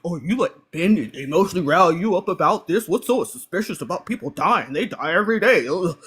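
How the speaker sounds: mock the dismissive commenter's tone; a hint of a condescending know-it-all. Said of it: "This goofy down in the comments".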